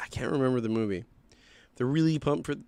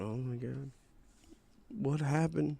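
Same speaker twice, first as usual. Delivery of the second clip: stunned bystander; 8 dB quieter, slower than usual. Retold or imitated. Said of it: "I'm just going".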